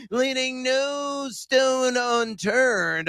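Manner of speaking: slow grand slogan; drawn-out slogan. Acted. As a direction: stretch it like a big tagline. Host's slow emphatic tag line.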